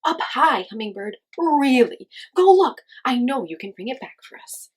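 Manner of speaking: loud, coaxing plea; urging. A narrator's reading. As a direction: urge your friend to go. Coyote pleads.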